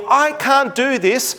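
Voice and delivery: put-on drawn-out childish complaint; imitating child whine. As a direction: whine it like a stuck kid. Daughter's whine imitated again.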